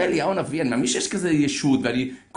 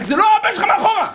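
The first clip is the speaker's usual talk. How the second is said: loud, much higher mock yell from a passing car; yelled at driver; 7 dB louder, faster than usual. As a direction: shout it out the window, high and loud. Driver yells while overtaking.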